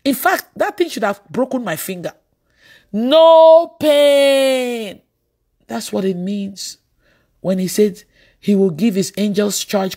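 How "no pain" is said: long, loud, held-out shout of wonder; exultant cry. Retold or imitated. Preacher climaxing a testimony of divine protection, exultant cry. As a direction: stretch both words out loud.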